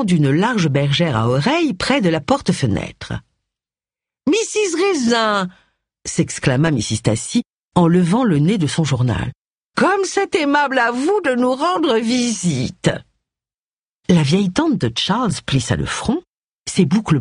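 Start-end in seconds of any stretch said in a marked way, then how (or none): 4.3-5.5 put-on high, slow, nasal old-lady exclamation; nasal old aunt. A narrator's reading. Elderly aunt surprised to see Agatha, high nasal exclaim. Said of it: "une voix nasillarde". 9.8-12.9 put-on high, slow, nasal old-lady exclamation; nasal old aunt. A narrator's reading. Elderly aunt surprised to see Agatha, high nasal exclaim. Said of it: "une voix nasillarde".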